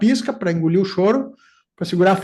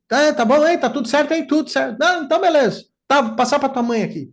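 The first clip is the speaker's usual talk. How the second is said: hurried father; 8 semitones higher than usual. Retold or imitated. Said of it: "meu pai era rapidinho".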